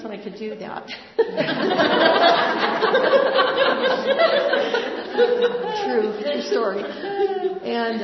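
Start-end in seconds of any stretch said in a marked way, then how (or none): 0.9-5.8 a big, high, loud laugh bursting out; real belly laugh, much higher and louder; loud burst of laughter. Real, not acted. Retelling jumping off exam table. 5.8-8.0 high and loud through the laughter; laughing while talking. Real, not acted. Still laughing after the story.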